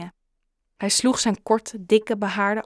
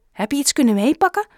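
eager, quick, higher and louder question; enthusiastic; 6 semitones higher, 7 dB louder, faster than usual. A narrator's reading. Father asking what his son rescued, enthusiastic. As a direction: ask it brightly and fast, full of hope. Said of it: "vroeg zijn vader enthousiast".

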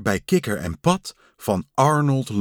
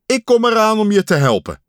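higher, louder and fast; urgent call; 9 semitones higher, 7 dB louder, faster than usual. Acted. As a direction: hurry out the words as you rush off. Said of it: "riep Pat". (haastig roepend)

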